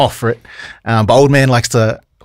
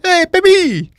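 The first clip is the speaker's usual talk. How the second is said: creepy uncle; slower than usual. Retold or imitated. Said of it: "and he'd be like".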